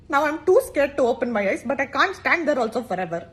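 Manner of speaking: ordinary delivery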